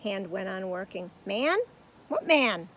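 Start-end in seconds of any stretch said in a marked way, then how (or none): 1.2-2.7 stagehand voice. A narrator's reading. Stagehand replies.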